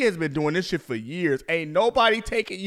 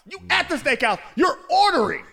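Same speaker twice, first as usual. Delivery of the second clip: incredulous shout; 8 semitones higher than usual. Real, not acted. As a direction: rant at the absurd choice. Incredulous ranting.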